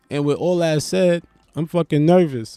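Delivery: ordinary talk, nothing marked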